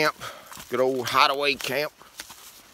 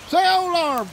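higher, louder urging to the dog; coaxing, higher and louder; 12 semitones higher, 5 dB louder than usual. Shown as a demonstration. Urging Pig to speak Spanish, higher and louder coaxing. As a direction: coax him, pitched up and louder. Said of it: "He doesn't know how to say it".

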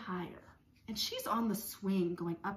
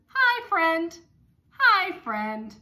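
high, loud happy hi; cheery child greeting; 7 semitones higher, 11 dB louder, much slower than usual. Acted. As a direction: wave hello from the sky. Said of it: "she says".

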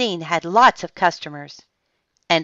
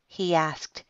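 ordinary delivery

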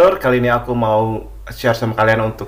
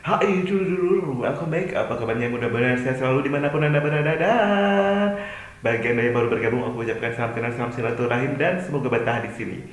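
host intro; 3 semitones higher, 4 dB quieter than usual. Acted. Vlog intro greeting.

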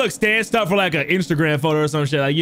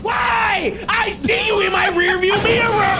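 shouting; 13 semitones higher, slower than usual. Real, not acted. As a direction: yell it at the pursuer. Shouting at chasing player.

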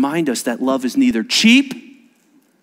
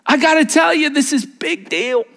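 much higher, louder, drawn-out urging; emphatic plea; 14 semitones higher, 3 dB louder than usual. Retold or imitated. Pastor pleading with the couple about the weight of it. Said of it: "And I'm going".

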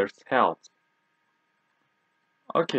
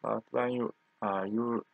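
ordinary talk, nothing marked

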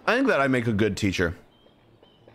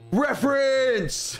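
excited; 10 semitones higher, much slower than usual. Real, not acted. Delighted at game reference.

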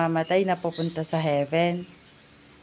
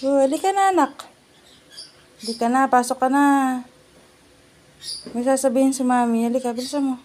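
coaxing mommy voice; 6 semitones higher, faster than usual. Real, not acted. Mother calls her child in before bad news, higher coaxing tone.

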